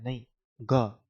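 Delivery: ग said as a hard g, not ज; hard g sound. Shown as a demonstration. Teacher correcting confusion between g and j. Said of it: "confuse मत होना कि जी में ज".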